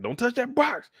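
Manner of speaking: a hammed-up stern dad. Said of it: "He said".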